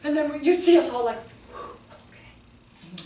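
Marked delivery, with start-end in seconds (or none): 1.5-3.0 hushed, voiceless okay; spent, quiet composure. Acted. Teachers returning upstairs after venting, hushed composure. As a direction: let it out on a breath, acting recovered.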